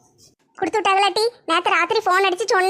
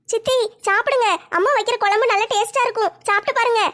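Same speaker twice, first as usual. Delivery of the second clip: put-on higher, girlish daughter asking her mother; young daughter voice; 4 semitones higher than usual. Daughter asks mother about the sofa, girlish higher voice. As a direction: play the curious teenage girl, pitched up.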